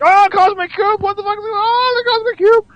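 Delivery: very high falsetto fan shriek; ecstatic comic fan. Retold or imitated. Comic fan spotting the Cosmic Cube, mock shrieking excitement. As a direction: squeal it like an overjoyed fan, hammed up. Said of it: "And the comic".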